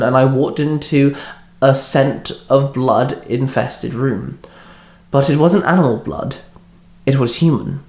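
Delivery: ordinary delivery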